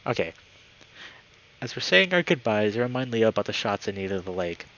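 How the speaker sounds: usual speaking voice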